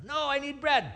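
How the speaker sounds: high, pushy plea at the door; high pleading. Retold or imitated. A friend begging a neighbor for bread, high pleading.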